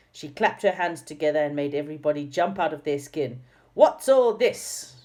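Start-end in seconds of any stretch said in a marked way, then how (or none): 3.7-5.0 much higher, louder, slow and fierce; fierce snap. A narrator's reading. Dame Snap catching the naughty pixies, fierce and loud. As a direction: bark it out to the whole room. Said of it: "she snapped loudly in a very fierce voice".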